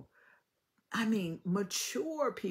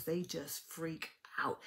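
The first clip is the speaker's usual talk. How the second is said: whispered aside; slower than usual. Acted. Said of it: "whispered aside".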